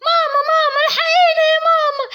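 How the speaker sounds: put-on panicked little girl. Little girls shouting for mom about the clay, high and panicked. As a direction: call for mom like a scared kid.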